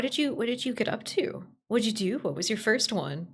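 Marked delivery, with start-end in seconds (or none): none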